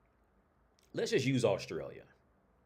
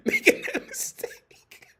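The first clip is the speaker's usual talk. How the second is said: laughing; 8 dB louder, slower than usual. Real, not acted. Laughing through question.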